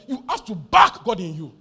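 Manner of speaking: "back" hit hard and loud. Shown as a demonstration. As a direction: drive it home to the crowd. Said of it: "Do you get?".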